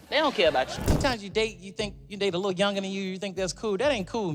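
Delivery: ordinary delivery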